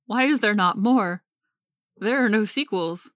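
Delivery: excited outburst that keeps climbing; eager demand. Real, not acted. Eager for more about Frankweiler.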